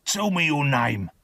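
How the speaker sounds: rough convict's growl. A narrator's reading. Convict questions the frightened boy, growling. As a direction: growl the demand menacingly. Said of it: "the man growled".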